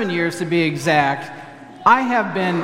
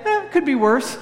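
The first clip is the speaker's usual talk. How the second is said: Minnesotan shrug; 6 semitones higher than usual. Retold or imitated. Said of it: "and you say".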